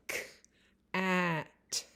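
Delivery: K-at with the k split off from at; segmented. Shown as a demonstration. Teacher breaking cat into sounds for a child. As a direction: separate the k sound before at. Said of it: "Listen to me".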